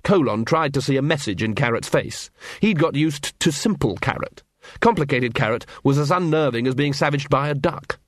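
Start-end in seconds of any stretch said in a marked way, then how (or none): none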